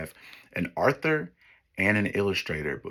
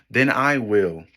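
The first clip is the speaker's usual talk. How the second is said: louder, higher firm hen; determined hen; 3 semitones higher, 8 dB louder than usual. Acted. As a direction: announce it proudly as the hen. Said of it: "Remember, I'm the hen".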